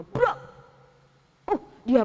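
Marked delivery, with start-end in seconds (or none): 0.0-0.4 door slam. Acted. Menutup pintu kamarnya.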